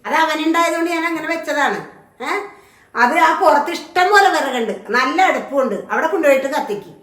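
loud, indignant telling-off; a mother-in-law berating the bride over the gas stove; angry scolding. Acted. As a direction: snap at her for wasting the gas.

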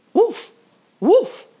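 high, loud yappy woofs; barking dog. A narrator's reading. Little dog barking at the school bus. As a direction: bark it like an eager little dog. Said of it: "barked Titus".

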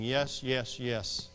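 low, soft, calm repeated YES; hushed, settled affirmation; God's quiet assent. Acted. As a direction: answer gently and low, unruffled. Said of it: "And God was saying".

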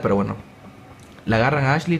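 ordinary talk, nothing marked

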